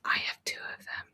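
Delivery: whispered confession. Pretend. Cohost confesses owning two elves, whispering. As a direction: admit it under your breath.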